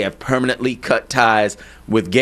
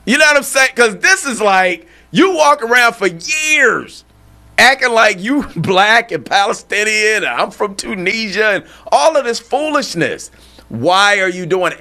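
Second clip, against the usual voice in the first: indignant disbelief; 7 semitones higher than usual. Real, not acted. Outraged at years of lying.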